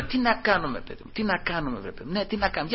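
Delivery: very soft, repeated fretting; hushed conferring. Acted. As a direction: confer under your breath, at a loss. Acts Pharisees conferring in perplexity. (χαμηλόφωνη αμηχανία)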